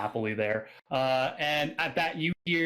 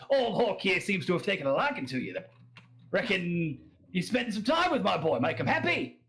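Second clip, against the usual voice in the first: higher, pushy Australian tavern voice; Australian accent; 7 semitones higher than usual. Acted. Drunk local urges newcomer to join Hork, hearty Australian accent. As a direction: talk a stranger round like a tipsy Australian. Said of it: "that's my Australian accent".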